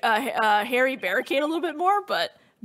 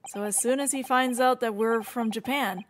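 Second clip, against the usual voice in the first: ordinary talk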